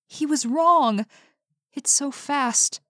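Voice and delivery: put-on scared girl, dropping to a hushed dread; high frightened voice sinking to a slow hush; terrified. A narrator's reading. Carmen terrified of creature.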